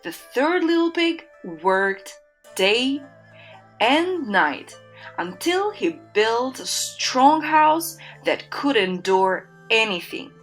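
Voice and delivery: usual speaking voice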